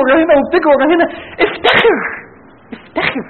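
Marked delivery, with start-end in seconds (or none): 1.4-2.0 افتخر called out high and ringing; rally them with افتخر; proud exhortation. Acted. Preacher urging the youth to be proud, ringing high. 2.8-3.2 افتخر echoed softly, pulled down; soft repeat. Acted. Preacher repeating his call to the youth, hushed.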